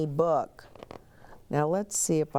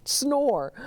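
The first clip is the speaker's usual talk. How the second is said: ordinary delivery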